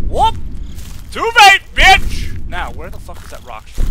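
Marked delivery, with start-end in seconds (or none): 0.1-2.5 high, loud gloating shout; triumphant yelled taunt. Real, not acted. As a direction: gloat at the monster. Taunts the monster after escaping.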